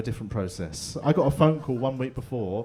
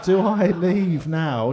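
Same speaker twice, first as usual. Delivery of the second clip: slow panicked question; 5 semitones higher, 7 dB louder, much slower than usual. Retold or imitated. Panic dilemma.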